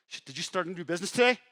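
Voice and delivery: mock incredulous demand from a fed-up spouse; frustrated ex-wife. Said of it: "Imagine how frustrating that was".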